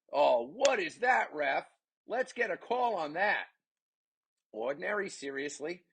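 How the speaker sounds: high-pitched disbelief at the referee; indignant protest. Real, not acted. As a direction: complain as an outraged commentator.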